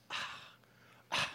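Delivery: breathy, fed-up sigh, imitated; heavy mock sighs; exasperated sigh. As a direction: mimic a wife sighing through a botched joke. Wife exasperated at botched joke.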